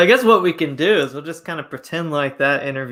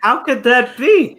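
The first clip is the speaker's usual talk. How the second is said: high, loud mock disbelief, crying out; teasing fake outrage with a crying edge; 9 semitones higher, 6 dB louder than usual. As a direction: ham up being shocked by the word nag. Teasing mock disbelief at "housewife, nag.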